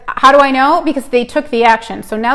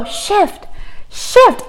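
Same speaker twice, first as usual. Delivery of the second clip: mom's mock shift; 10 semitones higher than usual. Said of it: "you can go".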